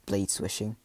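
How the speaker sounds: ordinary delivery, nothing marked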